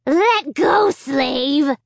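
high, loud squealing puppet voice; squealing dummy. A narrator's reading. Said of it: "he squealed".

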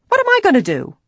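high, loud wail of dismay; desperate high-pitched outcry; distressed cry. A narrator's reading. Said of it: "the woman cried".

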